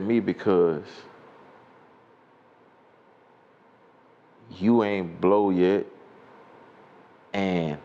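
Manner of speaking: slow, with long pauses between phrases; deliberate quoted reply. Retold or imitated. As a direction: recite the reply bit by bit, letting each piece hang. Quotes rival's typed reply, drawn out.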